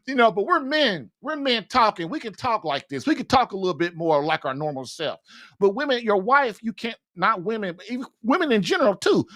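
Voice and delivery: ordinary talk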